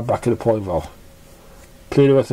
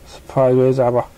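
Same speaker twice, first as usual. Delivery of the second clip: ordinary delivery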